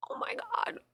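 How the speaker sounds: high, whispered amazement; awed whisper. Real, not acted. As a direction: breathe it out amazed.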